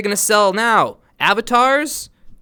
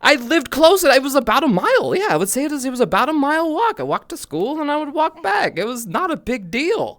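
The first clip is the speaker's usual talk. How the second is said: higher, heated insistence; defensive protest; 10 semitones higher, 6 dB louder than usual. Real, not acted. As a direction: argue back that it was normal. Defending himself to co-host.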